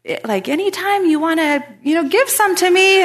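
high, loud retold hinting; neighbor's pointed request. Neighbor asks speaker to share oranges, high loud mock nudge. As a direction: play the neighbor nudging, hammed-up.

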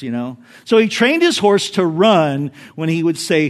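usual speaking voice

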